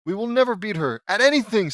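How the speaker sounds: high, loud whine of defeat; exasperated complaint. Acted. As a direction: wail that it's hopeless. Defeated sibling's acted complaint.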